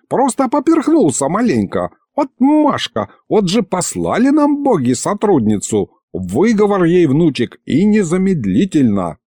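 высокий голос возмущённого деда; кипятись и жалуйся, голос вверх; закипятившись. A narrator's reading. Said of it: "закипятился Михалыч". (возмущённо, запальчиво)

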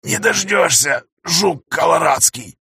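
high, slow raspy retort; hoarse rasp. Acted. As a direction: rasp it out despite the pain. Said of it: "хрипит Лёня".

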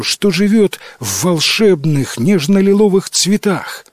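put-on high, earnest young girl's plea; brave girl pleading. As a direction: ask the spirit politely but bravely. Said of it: "не испугалась ... и ответила". (высокий голос смелой девушки, просящей духа)

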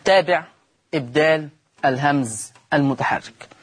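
usual speaking voice

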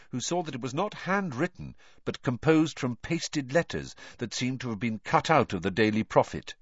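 ordinary delivery, nothing marked

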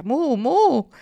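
high, loud moo; cow mooing. A narrator's reading. Cow reacts to the boy entering the stable, loud mooing. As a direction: bellow two moos. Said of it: "cominciarono a muggire".

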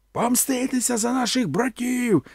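high, howled cry for vengeance; the warriors howl at Conan, a high howled cry; howling crowd. A narrator's reading. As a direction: howl it as the whole band. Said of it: "завили вони".

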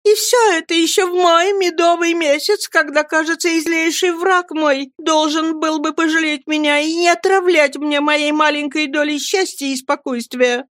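high, whiny, peevish wail; a spoiled wife complaining at everyone; petulant irritable cry. A narrator's reading. Mother scolds son and nurse in a high petulant wail. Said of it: "Капризно-раздражительным тоном кричала матушка".

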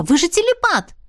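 high, surprised exclamation. A narrator's reading. Heroine reminds the dragon of his telepathy, surprised. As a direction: blurt it out in surprise. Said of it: "удивилась я".

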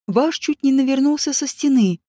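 plain reading voice

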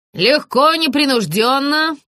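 high, mildly yelled direction; yelling. A narrator's reading. Director drills the walking actress, yelling mildly. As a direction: call it out high without full volume. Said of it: "заорал постановщик".

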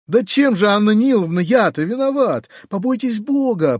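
begging to be told his fault; pleading voice. A narrator's reading. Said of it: "проговорил дядя умоляющим голосом". (умоляюще, выше обычного)

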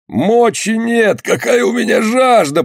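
very high, slow wail; long-drawn cry of a wounded man; agonised moan. A narrator's reading. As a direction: groan the words in desperate thirst. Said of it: "простонал шкипер Зели".

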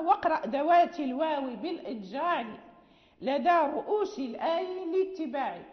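teacher reciting a rule in verse; verse recitation. Acted. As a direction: recite the verse firmly, in metre.

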